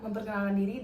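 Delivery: ordinary talk, nothing marked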